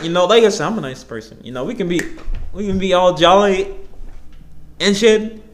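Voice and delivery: ordinary talk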